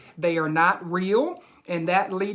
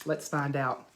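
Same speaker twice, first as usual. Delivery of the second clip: usual speaking voice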